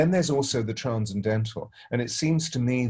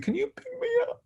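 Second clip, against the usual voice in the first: put-on much higher, small child's plea; little boy asking; faster than usual. Grieving godson asks to be picked up, small high voice. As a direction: ask it like a sad little boy wanting to be held.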